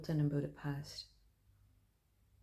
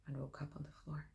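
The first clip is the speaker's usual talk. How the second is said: whispering; 6 dB quieter, faster than usual. Acted. Waking after blackout.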